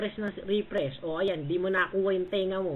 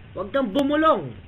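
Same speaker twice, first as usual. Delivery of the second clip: shouting; 2 semitones higher, 8 dB louder than usual. Real, not acted. Yelling at opponent over mic.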